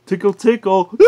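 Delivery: usual speaking voice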